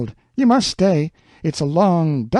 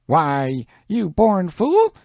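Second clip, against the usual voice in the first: angry Aunt Sally; 7 semitones higher, much slower than usual. A narrator's reading. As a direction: sputter with outrage. Sally scolds Tom.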